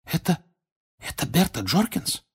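high, halting astonished question. A narrator's reading. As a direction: stumble into the question in wonder. Harry's hushed astonished question continues.

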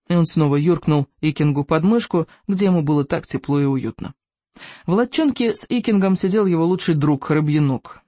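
usual speaking voice

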